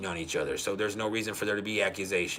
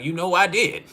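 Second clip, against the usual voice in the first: higher, louder mock comeback; retold defiant retort; 8 semitones higher, 6 dB louder than usual. Wife snapping back at husband in a retold spat, defiant.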